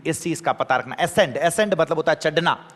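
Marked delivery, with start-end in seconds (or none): none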